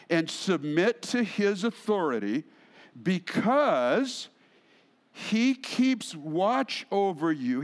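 slow, phrase-by-phrase scripture reading. Shown as a demonstration. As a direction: read deliberately, pausing between phrases. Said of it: "let's read it again slowly".

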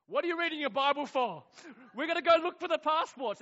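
loud, high re-enacted scolding; exasperated outburst. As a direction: act out an agitated demand at full voice. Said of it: "I had the nerve to say".

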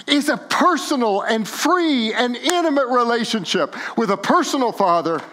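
high, loud, fervent preaching; full-force sermon climax; impassioned preaching. Real, not acted. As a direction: preach it out with rising fervor.

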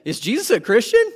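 high, incredulous question, laughing; mock surprise. Congregation's imagined reaction, high mock disbelief. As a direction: act out the congregation's shock. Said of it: "How many of you went".

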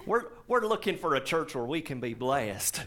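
put-on Southern accent. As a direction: give it a Southern church lady's accent. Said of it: "I picture this in a Southern accent".

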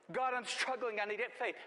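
high, loud mock cry to God; hammed-up desperate begging; pleading prayer. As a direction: blurt out an urgent plea for help. Acted struggling plea to God.

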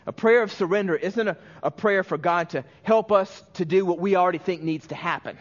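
normal talking voice

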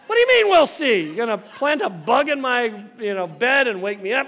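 loud, high, indignant outburst; outraged retort. Acted. Boy answering the smug man's challenge, outraged retort. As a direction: explode in comic disbelief.

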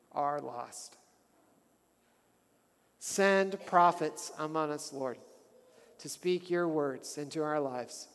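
measured, earnest prayer voice; a pastor closing in fervent prayer. Acted.

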